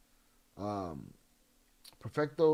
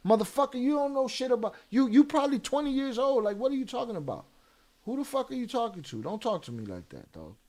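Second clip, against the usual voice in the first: high-pitched angry challenge; indignant outburst; 8 semitones higher, 3 dB louder than usual. Real, not acted. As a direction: challenge the chatter, voice pitched up in anger. Host cusses out condescending chatter.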